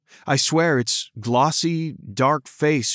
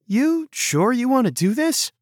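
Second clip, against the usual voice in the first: lightly voiced girl; 11 semitones higher than usual. A narrator's reading. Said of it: "Rena asked".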